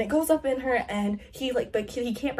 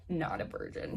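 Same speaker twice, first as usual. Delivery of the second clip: whispered reveal; 6 semitones lower, 7 dB quieter than usual. Shown as a demonstration. Juicy plot reveal.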